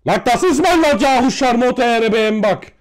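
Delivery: loud, high-pitched angry outburst; outraged retort. Pretend. Angry retort to being told go home.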